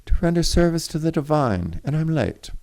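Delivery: high, loud hasty reply; shot back hastily. A narrator's reading. Alice answers Jean Noël on her way out, hasty. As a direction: toss it back over your shoulder in a rush. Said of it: "Alice shot back hastily over her shoulder".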